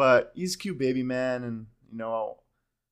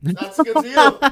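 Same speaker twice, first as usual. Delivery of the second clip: higher and louder put-on voice; mock bargain voice; 12 semitones higher, 11 dB louder, slower than usual. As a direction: ham up a thrilled bargain hunter. Mock shopper voice.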